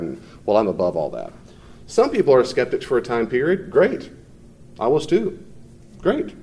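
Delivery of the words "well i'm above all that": low, self-important dismissal; pompous. Retold or imitated. Teacher mimicking people who think they're above it, pompous. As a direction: imitate someone loftily above it all. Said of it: "people who are pompous who say it like that".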